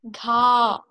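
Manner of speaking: Ga held a little longer; teacher models a longer syllable. Shown as a demonstration. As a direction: stretch the Ga out. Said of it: "A little longer".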